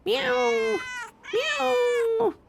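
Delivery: high drawn-out meow; high pleading meows; high cat meow. A narrator's reading. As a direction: mew like an impatient cat. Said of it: "interrupted Simpkin".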